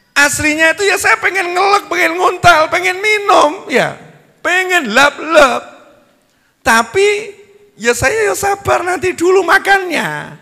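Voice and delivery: squeaky exasperated high voice; high comic complaint. Retold or imitated. Preacher mocks his hunger in a high whining voice. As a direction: whine it out high and frantic.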